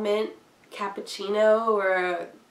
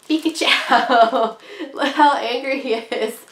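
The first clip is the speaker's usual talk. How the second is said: loud, slow shout through laughter; laughing; 4 semitones higher, 6 dB louder than usual. Real, not acted. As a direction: cry the name out while cracking up. Laughing at the angry Pikachu pin.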